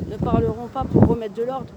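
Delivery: ordinary talk, nothing marked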